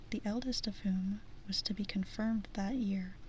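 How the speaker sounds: usual speaking voice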